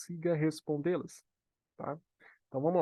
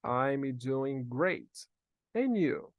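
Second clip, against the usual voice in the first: spaced out for learners to repeat; modelo para repetição; much slower than usual. Shown as a demonstration. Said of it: "Repete comigo". (bem devagar, para o aluno repetir)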